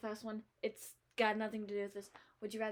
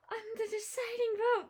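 dramatic gasp; 12 semitones higher than usual. Real, not acted.

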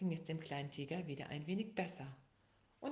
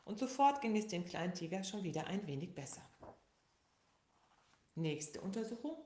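usual speaking voice

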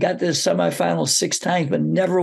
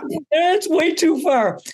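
high-pitched acted-out protest; alarmed cry; 12 semitones higher than usual. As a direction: cry out in mock alarm at a player. Coach's alarmed thought acted out.

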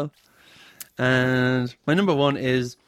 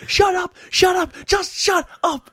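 very high, loud outburst; mock furious shouting; 5 dB louder, slower than usual. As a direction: imitate a screeching snap, slowing at the end. Acting out character's outburst.

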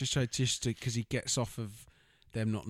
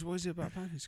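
high put-on question; lightly hammed-up high question; mock high voice; 9 semitones higher, faster than usual. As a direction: ask it in a squeaky mock voice. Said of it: "He goes".

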